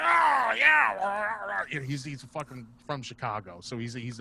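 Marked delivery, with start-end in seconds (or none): 0.0-1.6 high, booming wordless-style holler; loud comic impression. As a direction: bellow like the loudest guy in the room. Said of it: "Bob's really loud".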